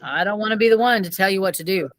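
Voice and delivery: usual speaking voice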